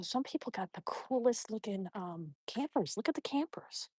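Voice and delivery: soft unvoiced whisper. Real, not acted. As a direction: hush it like a secret. Whispering on walk.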